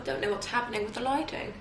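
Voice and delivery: soft, slightly British lilt; accidental British accent. Real, not acted. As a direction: slip quietly into an unconvincing British accent. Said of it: "keep falling into a British accent".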